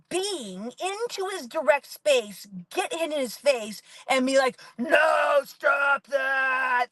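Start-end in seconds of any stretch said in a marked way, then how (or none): 4.8-6.9 stretched-out mock demonic shout; reenacted yelling. Mom reenacting yelling at her child, drawn-out shout. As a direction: yell it long and loud, hammed up. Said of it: "that's just gonna be my reenactment".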